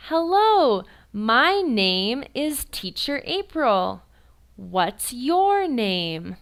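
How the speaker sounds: high, drawn-out, very slow introduction; slow, exaggerated teacher voice. Shown as a demonstration. Teacher greeting beginner students slowly and brightly. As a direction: overenunciate every word with actions. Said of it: "I say".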